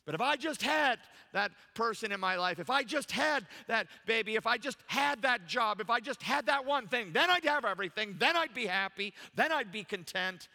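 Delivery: pleading if-only voice, hammered out; discontented wishing. Acted. Acts out discontented person's wishing.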